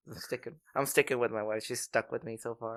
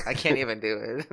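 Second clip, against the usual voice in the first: ordinary delivery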